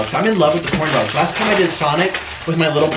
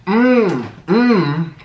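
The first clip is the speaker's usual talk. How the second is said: two high, falling mmm sounds; relishing hums; 4 semitones higher, 4 dB louder than usual. Real, not acted. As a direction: hum twice over a tasty mouthful. Relishing the food.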